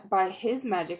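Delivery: plain reading voice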